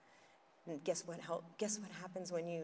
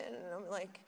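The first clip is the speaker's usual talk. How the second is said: usual speaking voice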